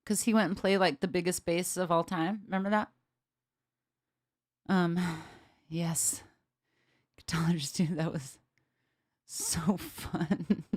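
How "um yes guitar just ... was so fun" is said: whispering. Real, not acted. Whispered aside to chat.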